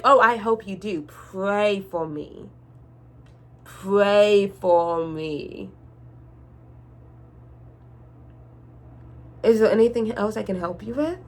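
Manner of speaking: drawn-out, slow, sugary sarcasm; sarcastic politeness. Retold or imitated. Cashier mocking the customer with slow fake politeness. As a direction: kill her with kindness, very slowly.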